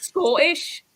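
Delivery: a mild stab at a Scottish accent on Scottish; Scottish said in a bad Scottish voice; light attempt at Scottish; Scottish try. Shown as a demonstration. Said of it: "say that again".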